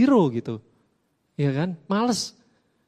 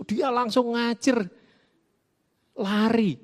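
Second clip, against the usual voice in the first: ordinary delivery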